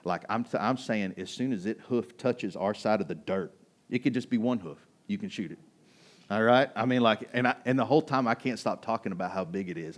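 ordinary talk

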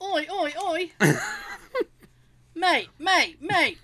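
cheeky Cockney calling; mate, mate, mate in a Cockney lilt; mock British oi mate. British banter.

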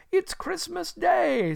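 very high, slow exclamation; overjoyed realisation. Acted. Scrooge to himself on learning the day, high overjoyed exclamation. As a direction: marvel to yourself in delight. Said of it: "said Scrooge to himself".

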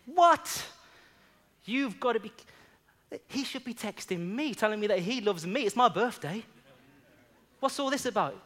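high, loud, offended complaint; indignant protest. Retold or imitated. John protesting to the Spirit on his birthday, indignant. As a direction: argue back like it's unfair.

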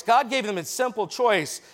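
normal talking voice

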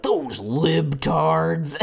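slow, sneering put-on voice; mocking alt-right dude. Mocking a smug alt-right guy with a slow sneer. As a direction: drag it out like a smug troll. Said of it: "and they're like".

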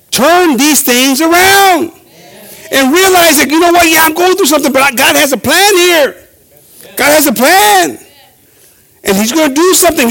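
high-pitched, forceful pulpit exhortation; impassioned, drawn-out preacher's call; preaching. Acted. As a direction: urge the congregation on with conviction.

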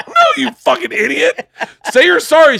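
high, loud exasperation through laughter; dad laughing while scolding his daughter; laughing mock yell.

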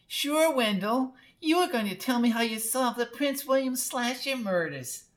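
high-pitched teasing sweetheart; Lulu, cooing blonde. A narrator's reading. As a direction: play up to him in a high voice.

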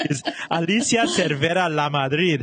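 put-on name rolled out in a playful Miami accent. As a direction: say her name with Miami Spanish flair. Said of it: "How do you like my Miami accent?".

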